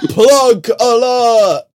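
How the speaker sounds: slow, high, loud plug alert; drawn-out callout. Acted. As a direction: stretch it like a siren. Echoing the jingle slowly.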